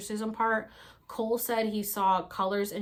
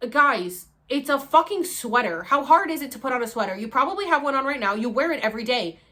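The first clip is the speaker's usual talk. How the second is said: loud, higher exasperated rant; losing it; 4 semitones higher, 8 dB louder than usual. Retold or imitated. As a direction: rant at them, fed up and loud. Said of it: "I literally lost it like that".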